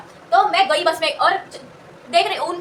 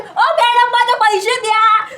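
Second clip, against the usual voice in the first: louder, slow, drawn-out demand for money; imitating the auto driver calling out slow and loud; 5 semitones higher, 6 dB louder, much slower than usual. Auto driver demanding his fare from her, loud and slow. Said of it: "auto वाला आके बोला".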